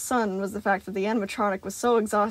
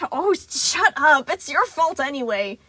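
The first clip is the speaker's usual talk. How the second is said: high, loud, sharp telling-off; scolding; 6 semitones higher, 7 dB louder, slower than usual. A narrator's reading. Sun scolding the empty air, high and loud. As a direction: snap at someone who isn't there. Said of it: "scolding to the empty air".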